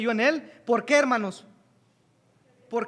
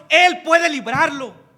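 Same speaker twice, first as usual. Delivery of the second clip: loud, slow declaration on Él; slow, ringing proclamation; preaching peak; 5 semitones higher, 12 dB louder, slower than usual. Acted. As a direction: shout the promise to the church. Preaching climax.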